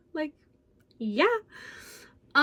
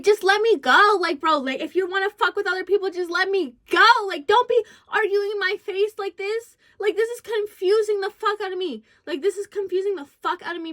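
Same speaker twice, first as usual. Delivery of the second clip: frustrated venting; 6 semitones higher, 4 dB louder than usual. Real, not acted.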